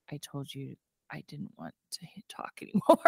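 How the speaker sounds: husky whisper, scuffed and fed up; hoarse whisper. Retold or imitated. Husband whispering to his wife from the road. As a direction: hiss it under the breath. Husband after rolling out of car.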